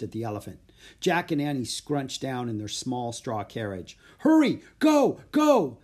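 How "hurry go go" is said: high, loud bursts of urging; urgent calling. A narrator's reading. Boy chased by merchants urges elephant, urgent shouts. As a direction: shout to get away from pursuers. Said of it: "Jack called to Morning Breeze".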